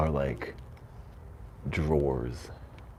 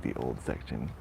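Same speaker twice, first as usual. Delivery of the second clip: ordinary delivery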